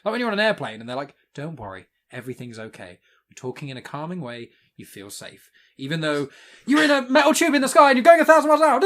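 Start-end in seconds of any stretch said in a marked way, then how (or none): 1.3-5.5 soft, low airline reassurance; calming voice. Retold or imitated. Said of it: "a calming person telling you things". 6.7-8.8 high, loud, rushed panic; mock alarm. Alarmed contrast to the calming voice.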